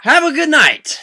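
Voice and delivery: loud, high call to the fans; show sign-off. Acted. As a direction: belt out the goodnight to a crowd. Host signs off to fans.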